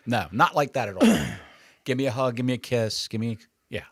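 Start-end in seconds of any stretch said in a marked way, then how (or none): none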